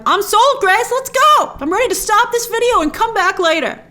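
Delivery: high, loud eager viewer voice. Pretend. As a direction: act out a viewer who can't wait to leave. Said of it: "you might be like".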